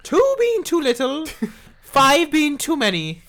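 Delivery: high, slow mock-scripture reading; silly high cleric laying down rules; high-pitched Holy Grail voice. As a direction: intone it slowly way up high. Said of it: "Their high-pitched voice".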